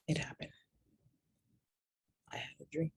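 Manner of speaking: whispered dramatic reveal; hushed reveal. Real, not acted. Telling viewers the dream came, in a hushed whisper. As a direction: breathe it out like a secret.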